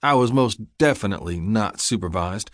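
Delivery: usual speaking voice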